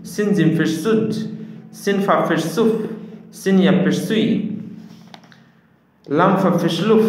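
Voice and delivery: high, projected lilting letter drill; spelling-drill recitation. Shown as a demonstration. Teacher leads learners down the chart, reciting.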